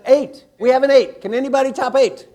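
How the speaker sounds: loud, high auctioneer-like call; calling out bids. Real, not acted. Coach asks the room who talked to most people, loud calling out. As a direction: shout the count across the room. Calls for higher counts from room.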